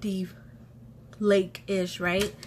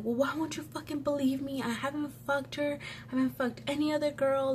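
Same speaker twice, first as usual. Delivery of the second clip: higher-pitched, softer imitation of his protest; mock an indignant denial in a raised, lighter voice; protesting innocence; 6 semitones higher, 4 dB quieter than usual. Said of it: "going on in how he was innocent".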